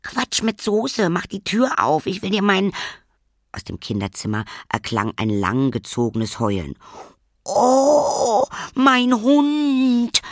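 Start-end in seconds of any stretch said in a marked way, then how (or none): 0.0-2.7 fed up, insisting at the door; cross big sister; impatient protest. Acted. Lilly impatient with her sister. 7.4-10.2 high, loud, whining lament; crying out for her dog; wailing cry. Acted.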